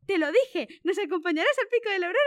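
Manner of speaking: fast, loud and high with excitement; eager rush of I-told-you-so joy; very excited. Acted. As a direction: blurt it out thrilled. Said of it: "dijo Dan muy emocionado".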